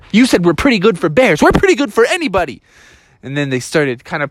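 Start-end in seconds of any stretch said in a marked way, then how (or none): 0.1-2.6 fast, higher-pitched bickering; indignant retort. Acted. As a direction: fire back quickly as an offended bear. Bears argue back.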